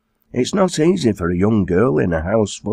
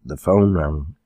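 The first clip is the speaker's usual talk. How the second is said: ordinary delivery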